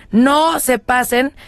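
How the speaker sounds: normal talking voice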